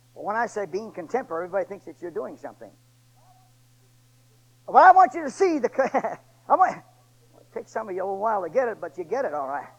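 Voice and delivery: ordinary talk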